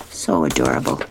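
usual speaking voice

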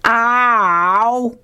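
sudden high yelp, "Ow!"; jolted yelp like he was just zapped; cartoon ouch, pitched high; yelp of pain. A narrator's reading. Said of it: "Sherman yells out".

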